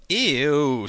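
loud, high, drawn-out "ew"; scowling disgust. A narrator's reading. Woman scowls at the men's crude list, disgusted. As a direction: recoil from it in distaste. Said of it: "scowled the woman".

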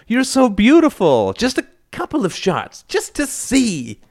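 very high-pitched wheedling; pleading, over-sweet persuasion; cajoling. A narrator's reading. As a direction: coax and flatter in a high voice. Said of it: "he cajoled".

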